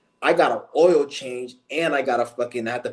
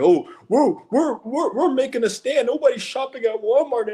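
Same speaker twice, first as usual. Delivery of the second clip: much higher, whiny mock voice; mocking dumb people; 12 semitones higher than usual. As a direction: parody them in a shrill-pitched sneer. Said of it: "you got dumbasses out here".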